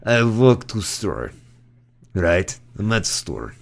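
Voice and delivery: put-on slow Russian-accented joke setup; old Russian lady. Old Russian amateur telling her joke, Russian accent. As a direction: imitate an old Russian comic, pausing between phrases. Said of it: "this old Russian lady".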